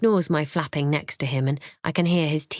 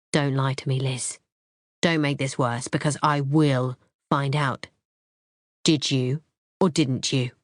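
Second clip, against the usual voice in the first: angry yet calm; 3 semitones lower than usual. A narrator's reading. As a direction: interrogate coldly with anger held down. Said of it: "He's angry and yet scarily calm and focused".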